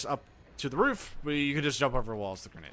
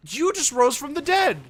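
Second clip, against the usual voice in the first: shocked outburst; 13 semitones higher, 11 dB louder than usual. Real, not acted. Enemy revives unexpectedly.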